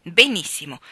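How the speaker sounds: loud, sour one-word grumble; bitter grumble. A narrator's reading. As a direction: grumble it out grudgingly. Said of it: "brontolò con amarezza". (brontolio forte e amaro)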